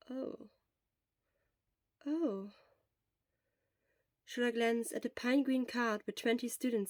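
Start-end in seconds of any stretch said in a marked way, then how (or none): none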